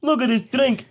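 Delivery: hammed-up Irish fighter pushing drinks; mock Conor bellowing over his whiskey; mock Irish boast. Mocking Conor selling his whiskey.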